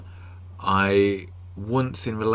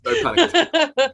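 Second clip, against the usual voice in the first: laughing; 6 dB louder, much slower than usual. Real, not acted. Joking about panic attacks.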